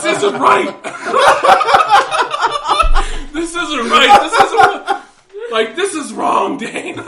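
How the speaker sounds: high, loud mock-sobbing mom, laughing through it; hammed-up crying scold; imitating crying mom. Son retells his mom scolding him in mock sobs. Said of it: "she's bawling, crying".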